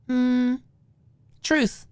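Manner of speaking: high, drawn-out hmm then truth; cautious choice. Acted. Maud warily picking truth against Alicia. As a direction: choose the safe option warily.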